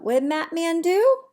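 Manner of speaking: bright, lively storybook reading; animated read-aloud. Acted. Teacher reading to her class, lively storybook voice. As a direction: read it big for the little ones.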